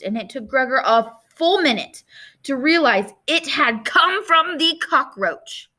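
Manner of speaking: ordinary delivery, nothing marked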